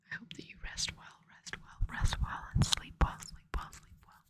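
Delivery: soft repeated whisper, fading away; hushed bedtime whisper, looping and fading; whispered ASMR sign-off. Real, not acted. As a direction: whisper them off to sleep, each repeat fainter.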